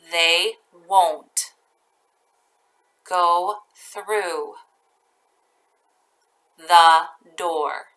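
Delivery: each chunk very slow with long pauses; teacher dictating a sentence to first graders, slowly; slow dictation. Shown as a demonstration. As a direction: dictate it piece by piece. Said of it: "I'll read each part very slowly".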